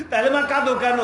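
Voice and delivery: high, loud questioning shout; urgent cry. Retold or imitated. Preacher as child asking mother about tears, high shout. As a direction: demand it of your mother at full voice.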